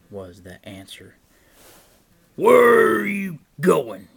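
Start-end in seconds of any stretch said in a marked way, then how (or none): 2.3-4.1 loud, raised troll demand; troll's yell. A narrator's reading. Troll demands to know of Big Billy, yelling. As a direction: bellow the question, hitting WHERE. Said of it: "yelled the troll".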